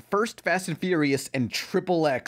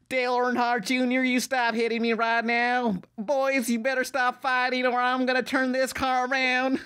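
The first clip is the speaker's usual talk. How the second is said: high, hammed-up Southern dad scolding kids; bad country accent; 6 semitones higher than usual. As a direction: overdo a twangy Southern parent warning. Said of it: "bad country accents".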